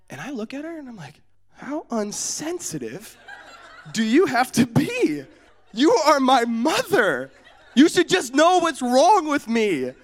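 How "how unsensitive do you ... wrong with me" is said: put-on slow, high-pitched child's outrage; mock indignation. Hurt boy berating his mom, slow loud mock outrage. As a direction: drag it out like a wounded, offended kid.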